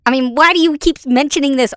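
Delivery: hammed-up shout at the script, high and loud; mock outburst. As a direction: blow up at the teleprompter in fake rage. Mock rant reading the script.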